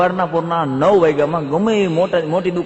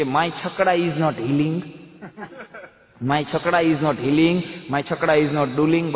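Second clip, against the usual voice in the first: broken English; much slower than usual. Acted. Villager speaks broken English.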